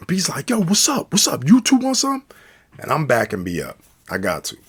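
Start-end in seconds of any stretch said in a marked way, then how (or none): 0.5-2.4 loud, high fight call-out; acted-out confrontational shouting; aggressive challenge. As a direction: dare them to step up. B challenges the other two.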